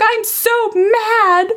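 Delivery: imitated high, drawn-out child's whine; upset small child. Upset daughter answering her mother, imitated childlike wail. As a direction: stretch it out high like a cross three-year-old. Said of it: "she was just like".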